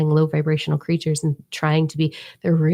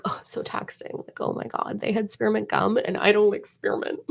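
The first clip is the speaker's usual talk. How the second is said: whispered mock complaint; mock a self-pitying whiner, hushed; pity party whine; 3 semitones higher, 4 dB quieter than usual. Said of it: "pity party of like".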